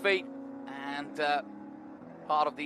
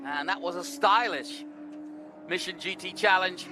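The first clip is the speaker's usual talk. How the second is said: live finish-line call, rising excitement; motorsport commentator; slower than usual. Real, not acted. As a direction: call the checkered flag like a race announcer.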